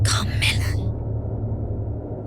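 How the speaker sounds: slow spellbound whisper of her name; fading entranced whisper. Acted. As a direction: breathe the name, enchanted. Dreamy vision of Carmilla.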